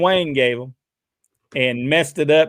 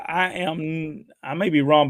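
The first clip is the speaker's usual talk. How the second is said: ordinary talk, nothing marked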